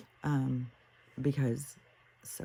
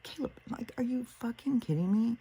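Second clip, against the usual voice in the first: high-pitched, disbelieving protest; incredulous outrage; 9 semitones higher than usual. Retold or imitated. Confronting Caleb over travel during a war, incredulous. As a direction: start hushed and high, then push into disbelief.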